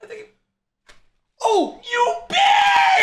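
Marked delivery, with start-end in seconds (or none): 1.4-3.0 sudden high, loud pained cry; pained yell. Real, not acted. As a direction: yell out as the wax rips. Arm hair waxed off.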